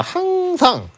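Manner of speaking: 항상 drawn out on a very high pitch; high, sing-out emphasis on 항상; emphatic repeat. Real, not acted. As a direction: stretch 항상 and lift it high to drill it in. Drilling a rule by repeating it.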